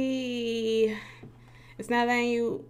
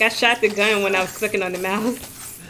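ordinary talk, nothing marked

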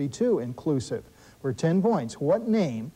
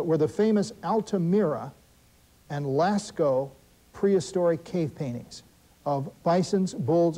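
ordinary talk

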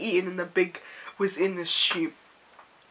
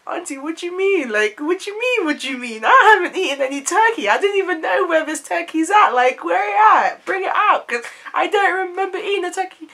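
higher, louder outraged niece; indignant protest; 10 semitones higher, 7 dB louder than usual. Retold or imitated. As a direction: protest your innocence loudly. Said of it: "And I'm like".

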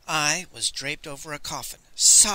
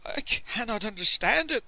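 higher-pitched helpless lament; bewildered merchant, voice raised; whimpering; 6 semitones higher than usual. A narrator's reading. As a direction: whimper it in mild distress. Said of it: "the merchant whimpered".

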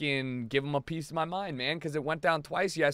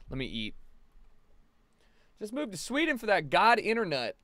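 ordinary talk, nothing marked

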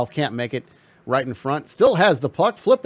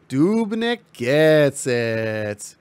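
very slow, stretched-out play call; drawn-out call; much slower than usual. Acted. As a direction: stretch every word of the call.

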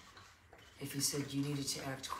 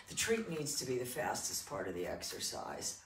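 normal talking voice

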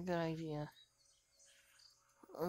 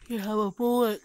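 frustrated yell; 3 semitones higher, 11 dB louder than usual. Real, not acted. Streamer yelling at game.

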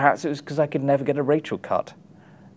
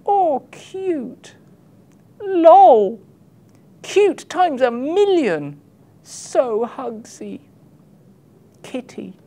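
much higher, cooing, mock-adoring fan voice; mocking gushy commenters; 12 semitones higher, 3 dB louder, much slower than usual. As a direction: coo it like a doting cat-lover, sending them up. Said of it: "you'd notice the comments".

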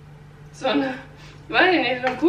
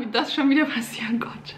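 embarrassed whisper; 4 dB quieter than usual. Real, not acted. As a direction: whisper it under your breath. Embarrassed aside.

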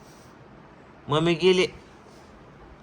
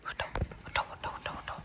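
whisper; 14 dB quieter, slower than usual. Real, not acted. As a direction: breathe the words, no voice. Parent whispering to wake child.

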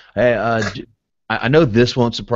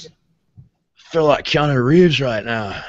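slow, laid-back surfer drawl; Keanu Reeves impression; 5 semitones higher, slower than usual. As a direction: do a lazy Keanu voice. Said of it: "That was my Keanu impression".

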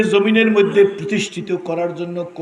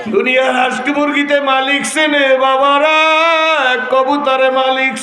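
melodic waz preaching; 13 semitones higher, 9 dB louder than usual. Acted. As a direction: preach in a sustained singing cry, stretching the phrase endings. (সুর করে উচ্চস্বরে ওয়াজ)

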